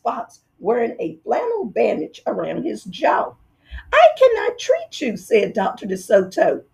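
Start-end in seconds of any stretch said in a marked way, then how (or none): 3.9-5.2 high, firm mouse dentist voice; firm little dentist. Acted. Mouse dentist refusing the fox, high and firm. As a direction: turn him away politely but firmly.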